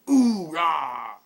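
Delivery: very loud barked battle cry; military shout. Acted. Soldier saluting the ponies, barking a loud battle cry. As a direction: bark it at attention.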